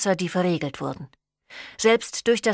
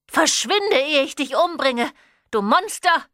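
shriek; 8 semitones higher than usual. A narrator's reading. Mother threatening her vampire son, high shriek. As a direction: scream it in panic. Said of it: "Ihr Ton steigerte sich zu einem Kreischen".

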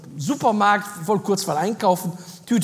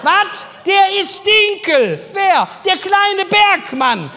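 laut; 8 semitones higher, 5 dB louder, much slower than usual. Retold or imitated. Lautes Hin und Her mit Helga.

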